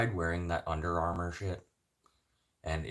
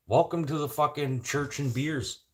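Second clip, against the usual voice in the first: ordinary delivery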